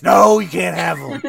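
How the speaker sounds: loud laughing refusal. Retold or imitated. Store staff refusing dice, laughing.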